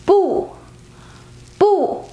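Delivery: "bu" in fourth tone, high and falling. Shown as a demonstration. Teacher showing learners the original tone of bu: fourth tone on "bu", high fall. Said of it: "is originally four tone".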